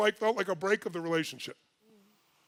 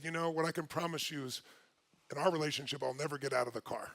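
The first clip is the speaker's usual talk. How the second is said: soft, lower, tender voice; earnest promise; 5 semitones lower, 8 dB quieter than usual. Retold or imitated. Husband retelling his wife's promise, hushed and sincere. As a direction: promise it gently and quietly.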